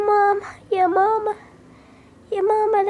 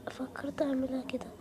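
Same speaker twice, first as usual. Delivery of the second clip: whisper; 5 semitones lower, 11 dB quieter than usual. Acted. Mother whispering her plan.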